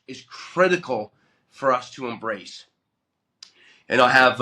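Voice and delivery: ordinary talk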